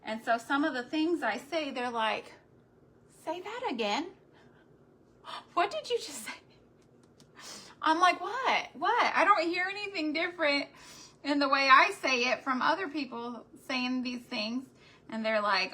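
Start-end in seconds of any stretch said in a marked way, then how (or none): 3.3-6.3 higher-pitched mock disbelief; playfully incredulous mimicry; teasing friends. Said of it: "will make fun of me". 8.2-14.6 high, loud mock bewilderment; re-enacted indignant puzzlement; baffled protest. Acting out her baffled reply.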